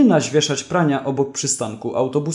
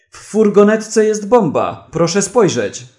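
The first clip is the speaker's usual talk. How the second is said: higher, louder narrated alarm; urgent shouting; 5 semitones higher, 4 dB louder than usual. A narrator's reading. Wega warning the court of a bomb, urgent shout. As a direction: shout a warning, starting high and loud. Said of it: "krzyczał".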